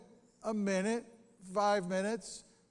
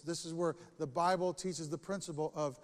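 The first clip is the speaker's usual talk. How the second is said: usual speaking voice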